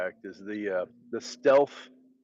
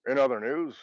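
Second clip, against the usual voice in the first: 1950s narrator; 3 semitones higher than usual. Pretend. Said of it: "an old announcer situation".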